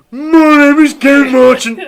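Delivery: loud, high, drawn-out mock Southampton accent; shouted Southampton mimicry. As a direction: taunt the teacher with his own Southampton accent, shouted. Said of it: "I would shout".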